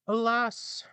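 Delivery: put-on old dog sighing. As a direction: sigh it as the weary hound. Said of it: "the dog replied".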